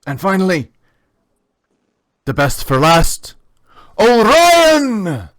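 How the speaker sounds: building to a loud, high shout of the name; grand finale call. Acted. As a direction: save the biggest shout for last. Announcer's big final introduction.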